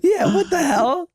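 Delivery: high laughing what the hell; laughing high exclamation; laughing outburst. Real, not acted. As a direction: break into a squeaky laugh. Laughing mock outrage.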